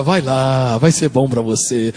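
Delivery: low, slow, smooth tempter; mock-seductive low purr; velvety devil voice. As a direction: coax them in a deep velvet voice. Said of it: "o diabo nunca vem com a voz aveludada".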